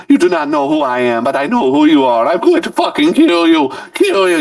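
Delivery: high, hammed-up phone threat; mock villain on the phone; menacing caller.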